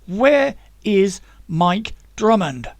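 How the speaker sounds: a firm demand that takes no refusal; firmly. Acted. Zack demanding an answer from Tommy, slow and firm. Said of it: "Zack said firmly".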